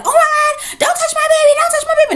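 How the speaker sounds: very high, loud, shrieking mother voice; frantic mother. Retold or imitated. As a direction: shriek like a panicked mother grabbing her child.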